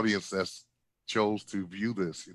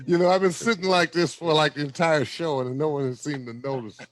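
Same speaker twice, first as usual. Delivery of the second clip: mock complaint; 7 semitones higher, 5 dB louder than usual. Real, not acted. Comic's mock complaint opener.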